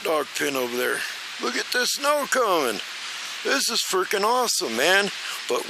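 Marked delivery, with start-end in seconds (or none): 1.4-5.1 excited. Real, not acted. Excited man watching snowstorm.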